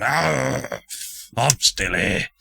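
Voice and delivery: a brief groaning outburst from a big shark brute; hammerhead shark man. Acted. Wounded shark fighter announcing he survived, mock roar. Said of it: "this steroid-induced hammerhead shark".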